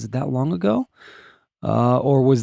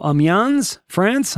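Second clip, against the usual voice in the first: tentative French pronunciation, questioning lift on France; attempted French accent; 5 semitones higher, much slower than usual. Shown as a demonstration. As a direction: have a go at French-sounding Amiens, France. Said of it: "I'm trying to say it French".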